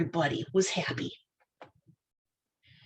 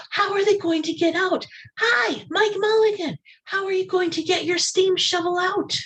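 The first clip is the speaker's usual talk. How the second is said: high, louder calling out; crowd calling out; 12 semitones higher, 3 dB louder than usual. Acted. Everybody calling to Mike Mulligan in the cellar, high and loud. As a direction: call down into the cellar. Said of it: "Then everybody said".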